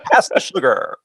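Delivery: podcast voice. Shown as a demonstration. Host asking his wife for sugar in the podcast voice. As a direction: say something mundane in the big radio voice. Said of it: "Stop doing your podcast voice".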